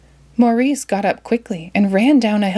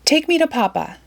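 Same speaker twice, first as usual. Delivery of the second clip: higher, firm command; 8 semitones higher than usual. A narrator's reading. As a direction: order the horse to go. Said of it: "she commanded".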